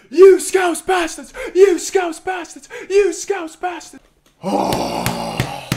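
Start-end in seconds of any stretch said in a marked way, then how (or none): 0.1-4.0 much higher, jeering shout; taunting yell. Real, not acted. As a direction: taunt the rival fans. Taunting rivals after a goal.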